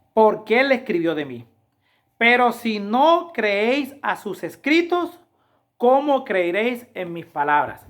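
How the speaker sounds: slow, loud, high proclaiming of the verse; solemn scripture reading. Acted. Preacher to congregation, quoting Jesus in a slow loud proclaiming voice. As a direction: proclaim each word as holy scripture. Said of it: "Dice la palabra de Dios".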